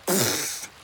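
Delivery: cross puff of breath; scoffing, huffy exhale; angry huff. A narrator's reading. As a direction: blow out air in annoyance. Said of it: "zegt Pip boos".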